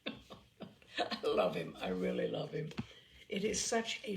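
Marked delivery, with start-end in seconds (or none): 0.0-2.7 laughing. Real, not acted.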